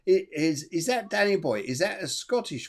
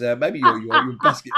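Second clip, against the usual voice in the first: laughing; 3 semitones higher, 8 dB louder than usual. Real, not acted. Host teasing a subway viewer about busking, laughing. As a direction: laugh out the tease.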